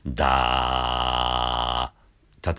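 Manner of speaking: slow low creaky da; fry on da. Shown as a demonstration. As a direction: rattle into the da. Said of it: "例えばだだったら".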